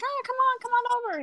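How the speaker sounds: sing-song come on over; high welcoming voice. Retold or imitated. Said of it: "Smyrna's always like".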